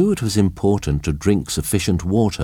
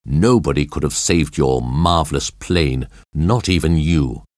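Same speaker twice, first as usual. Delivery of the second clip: plain reading voice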